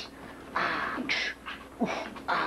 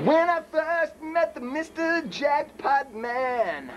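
slow announcer; 6 semitones higher, slower than usual. Acted. Reverent intro line.

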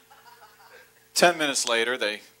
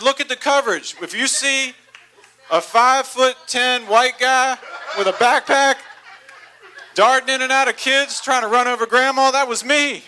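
excited telling; 9 semitones higher, 6 dB louder than usual. Retold or imitated.

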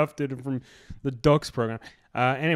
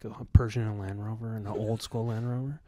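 low, very quiet guard voice; muttering border guard; 7 semitones lower, 11 dB quieter than usual. Retold or imitated. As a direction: mutter suspiciously under your breath, pitched down. Acts out suspicious border guard.

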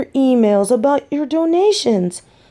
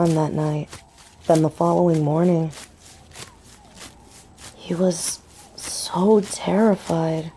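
soft musing; 2 semitones lower, 6 dB quieter than usual. Acted. Woman musing alone about boyfriend's secret, soft. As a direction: murmur to yourself with long pauses.

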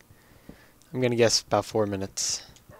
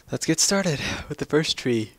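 talking through a yawn; 4 semitones higher than usual. Real, not acted. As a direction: yawn the words out. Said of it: "Sorry, I'm yawning".

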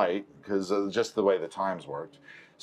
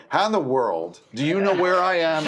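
exasperated; 8 semitones higher, 3 dB louder than usual. Retold or imitated. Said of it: "I'm like".

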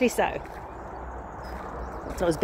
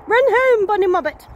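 high, louder playful call; mock warning call; 8 semitones higher, 4 dB louder than usual. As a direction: call out to send a bunny running. Calling out to the rabbit.

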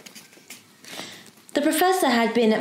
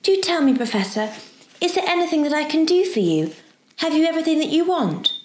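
put-on high, eager girlish questions; eager helpful girl; 7 semitones higher than usual. Girl offering the professor help, eager. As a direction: press sweetly to help, pitched high. Diana's voice in the story.

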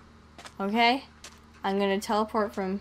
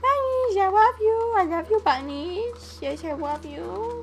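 squealing over bunnies; 10 semitones higher, 5 dB louder, slower than usual. Real, not acted. Player spots bunnies and squeals high and loud with babyish delight. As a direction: coo at the bunnies like they're puppies.

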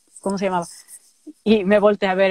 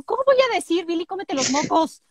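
high protest; 7 semitones higher than usual. Retold or imitated. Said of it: "La mía".

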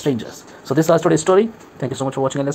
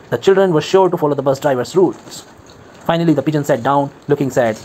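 plain reading voice

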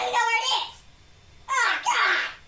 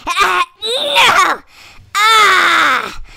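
anguished cry; 2 semitones lower, 6 dB louder, much slower than usual. Real, not acted. Has to stand up, groans.

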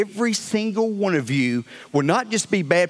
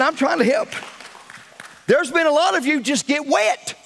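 preaching at full force; 8 semitones higher than usual. Acted. Pastor preaching to his church that only Jesus saves, full force. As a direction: build the shout toward the name of Jesus.

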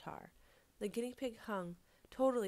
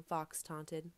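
ordinary delivery, nothing marked

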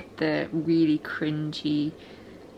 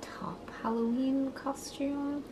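whispering over a crib; 4 semitones higher, 13 dB quieter, slower than usual. Real, not acted. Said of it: "Being very quiet because the baby's asleep".

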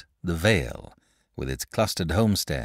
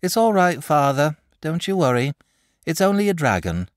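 sleepy; 10 semitones higher, 6 dB louder than usual. Acted. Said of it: "and said sleepily".

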